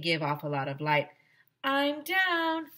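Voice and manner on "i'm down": announcing arrival. A narrator's reading. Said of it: "I said".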